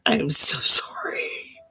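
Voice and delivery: slow whispered "I'm so sorry"; whispered apology. Real, not acted. Psychic apologizing to a viewer for mangling her name, whispered. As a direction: breathe the apology softly and slowly. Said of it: "I'm butchering that".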